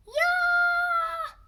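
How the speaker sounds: cheer. Acted.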